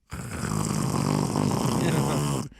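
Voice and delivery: long, low rumbling snore; imitated snore. Re-enacting colleague asleep on conference call, imitated snore.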